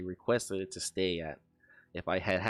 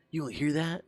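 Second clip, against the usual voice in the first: retold disbelief; 7 semitones higher than usual. Acts out friend hearing noises.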